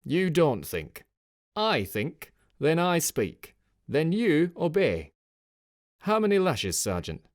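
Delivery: loud, high, slow cold scolding; stern rebuke. A narrator's reading. Officer berating his sergeant, loud and cold. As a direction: lay down the law word by word.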